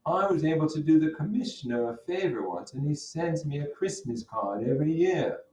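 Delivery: a hint of a smooth, casual Gatsby. Teacher voices Gatsby in reading.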